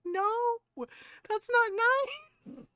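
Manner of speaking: high, drawn-out protest cracking into laughter; laughing protest. Retold or imitated. Aunt objecting to nephew's insult, high laughing protest.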